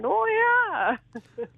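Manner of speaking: high, hammed-up Minnesotan oh yeah; Minnesota oh yeah. Comedian teasing the host with a mock Minnesota accent. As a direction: swoop the oh up then drop the yeah. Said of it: "I'm just gonna start saying".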